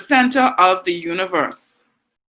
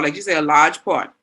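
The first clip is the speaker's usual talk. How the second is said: ordinary delivery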